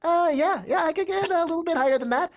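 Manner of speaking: climbing into a tiny high-pitched character voice. Retold or imitated. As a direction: push the voice way up like helium. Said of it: "it just kept going up and up and up into the stratosphere".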